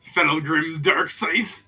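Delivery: loud, slow villain name reveal; dramatic announcement. Acted. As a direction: boom out the name slowly.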